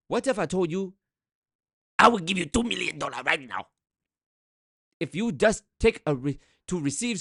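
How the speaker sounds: pushy Nigerian prince accent, hammed-up; mock scam pitch. Reacting to scam video, mocking prince pitch accent. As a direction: sell the fake prince fortune, pushy.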